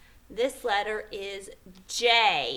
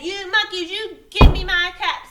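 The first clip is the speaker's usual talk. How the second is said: loud, raised-pitch angry demand; 7 semitones higher, 9 dB louder than usual. Acted. Peddler stamping his foot at monkeys, loud angry scolding. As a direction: stamp the words out crossly. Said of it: "Now he felt very angry".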